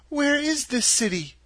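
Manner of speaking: put-on a small girl asking for help to get home; lightly voiced young girl Dorothy. A narrator's reading. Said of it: "asked Dorothy".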